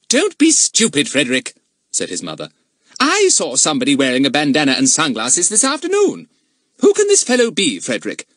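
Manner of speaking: high, loud stern lady; haughty mistress of the house. Acted. Narrator voices Mrs Trotville.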